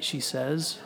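plain reading voice

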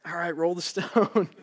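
laughing, slow, imagined Martha. Pretend. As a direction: chuckle it through as a nervous Martha. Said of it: "You're like".

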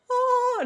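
high delighted oh; excited gasp. Retold or imitated. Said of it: "yo así de".

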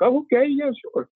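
high-pitched, startled okay; mock caught-off-guard yes; taken-aback agreement. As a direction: imitate someone agreeing on the spot. Retold startled agreement to request.

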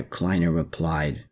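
ordinary delivery

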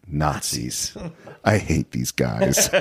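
low, slow grumble of disgust; gruff Indy impression. Quoting Indy's disgust at the Nazis below, low and slow. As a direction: growl it like a fed-up hero. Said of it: "And he says".

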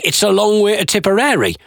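normal talking voice

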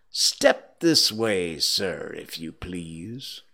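slow, ominous politeness sinking low; stern summons. Acted. Scrooge summons his late clerk, coldly polite. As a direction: order him over slowly, dropping away.

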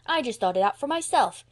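put-on proud boyish protest; indignant. A narrator's reading. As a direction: insist on taking the credit. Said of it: "cried Davy indignantly".

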